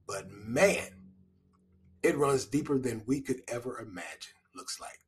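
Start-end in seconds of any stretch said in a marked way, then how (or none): none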